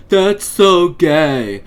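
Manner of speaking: slow, loud taunting high-schooler voice, leaning on so; mocking quote. Mocking high school bullies' taunt, slow and loud. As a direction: stretch it out like a dumb teen bully. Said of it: "I heard something similar all the time in high school".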